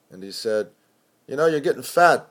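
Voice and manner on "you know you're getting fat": higher, louder jibe; teasing editor greeting. Acted. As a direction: rib him loudly.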